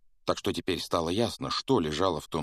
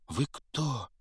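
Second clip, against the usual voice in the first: put-on small boy voice; 7 semitones higher than usual. A narrator's reading. Harry's timid question.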